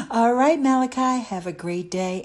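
usual speaking voice

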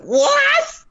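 high, disbelieving what; shocked what. Retold or imitated. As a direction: shriek it in disbelief. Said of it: "I'm like".

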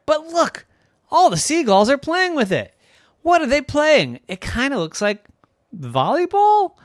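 high, eager exclamations; excited discovery. Acted. Sister spotting seagulls playing with the ball, excited. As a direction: point it out, thrilled and curious.

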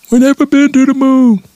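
very high, monotone caricature of a denier; mock hoax believer. Comedian mimics a stubborn moon denier, high mocking voice. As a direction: squeal it on one high held pitch.

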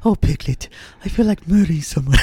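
sweet cartoon voice saying something dark; silly cartoon voice. Joke cartoon voice, then "just kidding.

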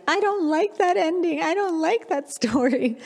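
high child's protest, lightly voiced; a hint of a tearful daughter; imitating crying child. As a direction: mimic a small girl upset at the ending. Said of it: "she just started crying".

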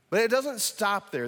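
ordinary delivery

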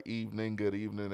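ordinary talk

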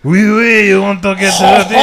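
mock French accent. Man starts talking to her in French, mock French accent. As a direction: burst into a hammed-up French seducer. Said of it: "like, in French".

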